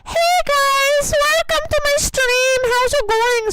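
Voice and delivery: high, loud hyped-up stream intro; shouted, high-pitched hello to chat; rowdy streamer greeting. Retold or imitated. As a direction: blast the welcome like an over-the-top streamer. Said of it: "and then I'll just go".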